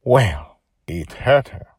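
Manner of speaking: ordinary delivery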